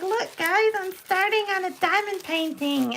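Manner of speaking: very high-pitched, mock-excited announcement; silly voice. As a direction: put on a squeaky, silly showing-off voice. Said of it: "talking about silly sounds and voices".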